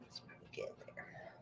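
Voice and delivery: whispered aside while tasting; hushed comment to herself. Real, not acted. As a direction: breathe it out under the breath. Quiet aside while tasting cookie.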